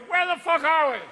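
high, loud comic panic; bewildered old sperm. Acted. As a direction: holler like a lost old recruit. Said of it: "two fifty-year-old sperms going".

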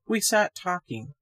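plain reading voice

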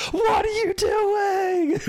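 slow drawn-out scream, high and loud; Walt screaming. Retold or imitated. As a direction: scream it like a shocked commentator. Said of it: "all I can hear is Walt screaming".

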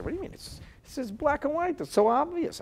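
high, loud smug outrage; mock smug outrage. Rabbi mimics a self-righteous man, loud and high. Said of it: "Person says".

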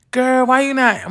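high-pitched mock scolding mom; imitating her mom. Mom fussing at daughter for not answering, high mock scold.

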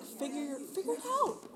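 rising, pressing herself; mock anxious self-talk; anxious self-push. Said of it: "'cause I'm like".

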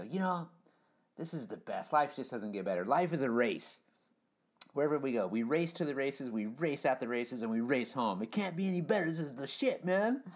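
imitating the Rev. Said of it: "turn the volume down and go".

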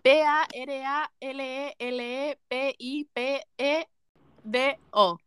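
slow, separated letters; deletreando. Shown as a demonstration. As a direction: spell it out slowly, letter by letter. Deletrea la palabra difícil. (deletreando despacio, letra por letra)

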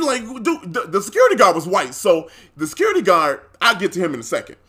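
ordinary talk, nothing marked

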